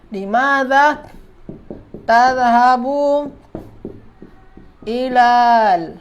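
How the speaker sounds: drawn-out words with long gaps; word-by-word dictation. Shown as a demonstration. Teacher writing out a student's sentence, slow word-by-word dictation. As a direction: dictate it piece by piece, stretching each word.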